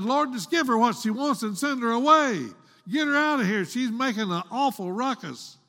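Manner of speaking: high-pitched, acted complaint; exasperated urging. Disciples want the noisy woman sent away, exasperated urging. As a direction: press him to get rid of a nuisance. Disciples urge Jesus to dismiss her.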